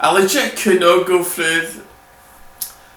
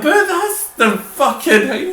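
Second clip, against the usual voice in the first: laughing; 9 semitones higher than usual. Real, not acted. Laughing fit telling Missy.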